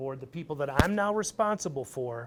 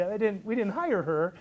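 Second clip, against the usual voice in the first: usual speaking voice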